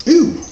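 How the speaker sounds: pained ooh. Acted. Needle stings the dog.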